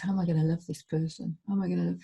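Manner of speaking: soft whispered dismay, under the breath; hushed inner despair. Retold or imitated.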